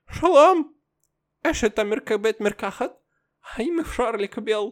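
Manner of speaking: put-on high, heavy Russian accent in Hebrew. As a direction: kids putting on thick Russian accent in Hebrew. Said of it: "בעברית עם מבטא רוסית נורא נורא כבד".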